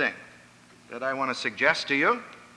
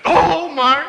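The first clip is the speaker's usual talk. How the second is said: higher and louder exasperated mother; a mother's shocked reproach at her kid; scolding mother; 11 semitones higher, 7 dB louder than usual. Retold or imitated. Hostess scolding her child.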